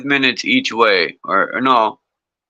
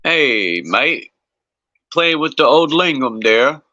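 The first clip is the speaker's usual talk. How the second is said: a clumsy attempt at an Australian accent, higher; bad Australian accent; 5 semitones higher, slower than usual. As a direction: ham up a bad Australian mate voice. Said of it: "That's a horrible accent".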